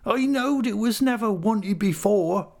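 very high, drawn-out self-pitying whine; pitiable whimper. A narrator's reading. Missus Gummidge feeling unwanted, high pitiable whimper. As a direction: whimper like a forlorn widow. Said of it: "with a pitiable whimper".

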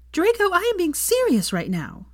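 high, sharp snap; snapped. A narrator's reading. Hermione snaps at Draco, irritated. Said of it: "she snapped".

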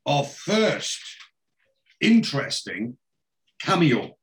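halting reveal with long pauses; dramatic build-up. Real, not acted. Host teasing a cameo to co-host, dramatic build-up. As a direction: spin out the announcement phrase by phrase.

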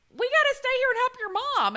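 high, loud earnest plea; daughter's friend insisting they help the mother. Retold or imitated. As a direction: insist firmly on staying to help. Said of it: "Her friend was like".

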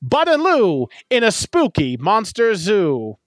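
a 1940s Transatlantic announcer pitching a monster comedy; old-timey transatlantic accent. Acted. Reading her own fake tagline in an old-timey Transatlantic accent. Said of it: "old-timey Atlanticism accent voice".

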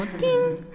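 very high sung ting like a phone alert; app notification ting. Retold or imitated. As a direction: chime it like the Uber Eats alert. Imita sonido de app. (tono agudo de notificación de app)

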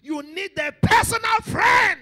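slow, high, very loud repeat; preaching shout. Real, not acted. Preacher telling the congregation of their need, shouting. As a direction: build to a drawn-out roar on the last word.

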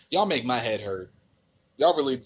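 normal talking voice

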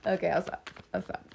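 normal talking voice

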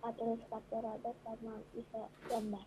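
faint, distant from the microphone; far from mic. Real, not acted. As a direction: speak from too far away. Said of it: "Suaranya kurang kedengaran". (jauh dari mikrofon)

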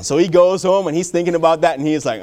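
normal talking voice

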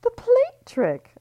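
shrill-pitched, loud prying question; Libby shrieking a nosy question at Ellie; screeching gossip. Acted. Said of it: "Libby screeched".

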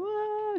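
high mock sob, a short wail; imitated crying wail. Imitating her own sob on the phone to her manager, high wail. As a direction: act out the helpless cry, pitched high.

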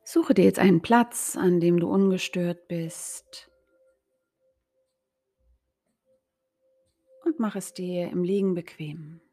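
ruhige, langsame Meditationsstimme mit langen Pausen; geführte Meditation. Acted. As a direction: sanft in die Entspannung führen. Leitet Yoga Nidra an. (Coach leitet Hörer ins Yoga Nidra, ruhig und langsam)

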